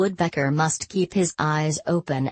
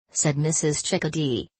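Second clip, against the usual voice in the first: ordinary delivery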